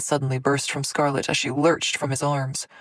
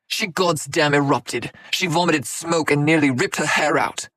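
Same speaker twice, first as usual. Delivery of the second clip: angry; 5 dB louder than usual. A narrator's reading. Angry retort.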